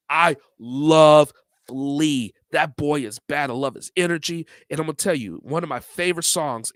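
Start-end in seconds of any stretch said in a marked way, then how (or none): 0.1-2.3 drawn out. Shown as a demonstration. Vlogger insisting he loves [name], stretched very slowly.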